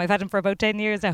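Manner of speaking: ordinary talk, nothing marked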